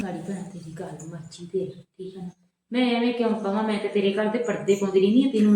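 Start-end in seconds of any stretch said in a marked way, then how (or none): 0.0-2.3 much softer, lower, giving in; hushed sulk. Acted. Friend backs down from the quarrel, hushed and much softer. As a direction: mutter it as if backing off the quarrel. Sulking retreat after quarrel.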